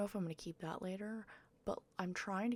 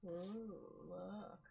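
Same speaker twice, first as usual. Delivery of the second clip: mock cute; 6 dB quieter than usual. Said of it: "I'm so cute".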